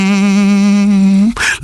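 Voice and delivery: long, steady hum on one note; calming meditative hum. Acted. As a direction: hum to settle yourself after a rant. Calming himself after the rant.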